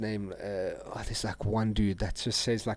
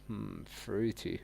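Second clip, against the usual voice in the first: normal talking voice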